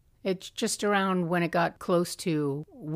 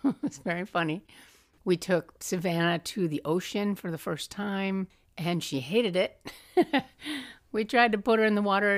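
normal talking voice

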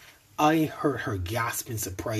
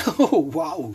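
high, loud laughing gasp; giggling, breathless woman; gasping between chortles; 8 semitones higher, 8 dB louder than usual. A narrator's reading. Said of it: "she gasped between chortles".

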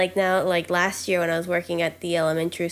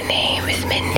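ASMR whisper. Pretend. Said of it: "like, A S M R things".

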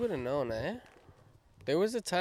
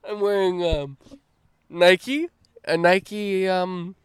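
1 playing an interviewer; 5 semitones higher, 7 dB louder than usual. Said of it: "I'm one of the interviewers".

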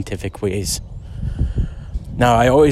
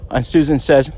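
ordinary delivery

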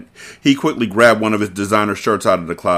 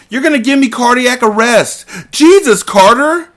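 panicked woman; 14 semitones higher, 6 dB louder than usual. Acted. Mia still yelling after shot.

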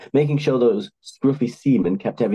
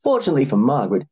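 plain reading voice

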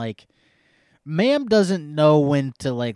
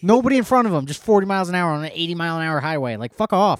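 loud, higher exasperated shouting; angry rant; 4 semitones higher, 5 dB louder, faster than usual. Real, not acted. Ranting about slow trucks.

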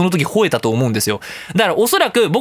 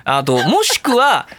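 usual speaking voice

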